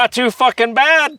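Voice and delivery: put-on high, slow, over-the-top British accent; mock upper-class British, drawn out. Showing off his British accent to his friend, hammed-up. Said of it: "best British accent you've ever".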